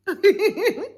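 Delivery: loud, high laugh; sudden loud high laugh; burst of laughter. Real, not acted. Laughing mid-story.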